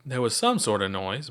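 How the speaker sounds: ordinary delivery, nothing marked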